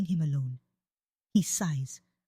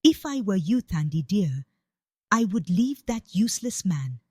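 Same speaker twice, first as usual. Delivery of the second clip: plain reading voice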